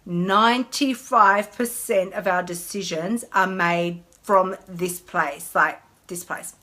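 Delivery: slowly and deliberately; slow emphasis. Shown as a demonstration. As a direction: let each word sink in, slow. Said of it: "breathe through that one".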